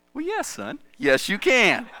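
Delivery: father offering spicy salsa to his son in the sly grandfather voice; grandfather's voice. Retold or imitated. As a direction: channel the teasing grandpa. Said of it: "my grandfather comes back in me".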